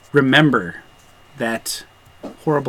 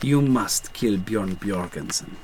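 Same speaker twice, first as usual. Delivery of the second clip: put-on slow, lower French-accented command; 4 semitones lower, 7 dB quieter than usual. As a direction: give a measured order in French accent. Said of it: "French accent".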